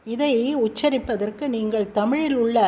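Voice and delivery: usual speaking voice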